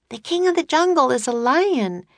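high, bright little sister calling Jack; girl's voice. A narrator's reading. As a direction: pitch up high for the young girl.

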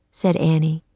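plain reading voice

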